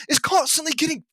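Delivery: high, drawn-out cry of frustration; exasperated. Real, not acted. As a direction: shout it slowly, at your wits' end. Climax of frustrated rant.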